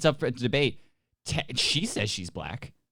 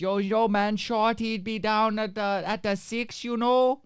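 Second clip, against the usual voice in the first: put-on Toronto accent; 10 semitones higher than usual. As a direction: put on a hammed-up Toronto slang voice. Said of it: "that's the Toronto accent".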